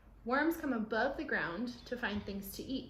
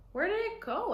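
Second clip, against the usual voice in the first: put-on high, loud curious kid; child voice; 11 semitones higher, 5 dB louder than usual. Grandchild asking grandma where the worm went. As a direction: ask it like an eager little kid.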